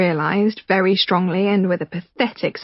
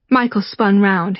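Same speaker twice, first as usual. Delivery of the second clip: normal talking voice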